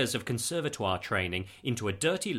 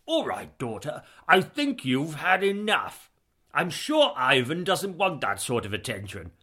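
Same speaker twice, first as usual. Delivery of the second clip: higher, louder stern dad; fatherly voice; 4 semitones higher, 5 dB louder than usual. Acted. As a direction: rein in daughter, mildly stern. Father scolding daughter.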